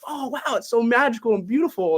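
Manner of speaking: high, wide-eyed gushing over snow; mock awe. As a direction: gush like a kid seeing snow for the first time. First sight of snow, awestruck.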